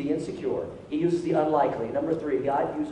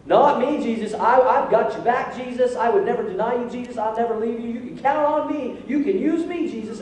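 eager protest; 5 semitones higher, 4 dB louder than usual. Retold or imitated. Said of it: "And Peter's like".

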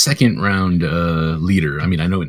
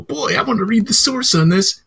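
much higher, gushing fan voice; eager fan voice. Retold or imitated. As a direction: chirp it like a thrilled moviegoer. Voicing an audience member.

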